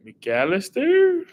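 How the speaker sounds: high, slow, questioning; a long, high, puzzled McAllister; drawn-out unsure guess. Real, not acted. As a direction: stretch it out, doubting the answer. Unsure guess at quiz answer.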